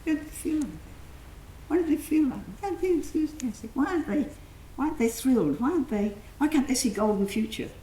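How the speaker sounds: high, exasperated repeated why; incredulous inner voice. Retold or imitated. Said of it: "I think".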